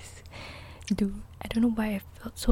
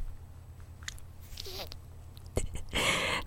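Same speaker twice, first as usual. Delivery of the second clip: whispered laugh; 9 semitones higher, 8 dB quieter than usual. Real, not acted. Laughs at awkward bus moment.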